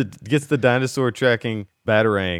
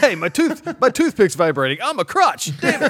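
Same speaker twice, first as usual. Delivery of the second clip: much higher, louder mock yelp of pain; yelping victim; 13 semitones higher, 4 dB louder than usual. Man bitten by a crocodile yelping in mock pain. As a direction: squeal like a cartoon victim.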